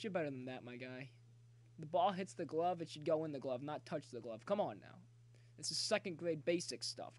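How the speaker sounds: ordinary delivery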